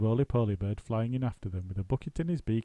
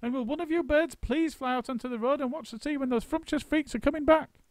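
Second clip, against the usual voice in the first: high, urgent orders; high urgent monkey; bossy monkey. A narrator's reading. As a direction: send a bird to keep watch. Mugglewump's orders.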